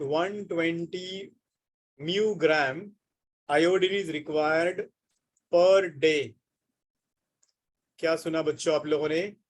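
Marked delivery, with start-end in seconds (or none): none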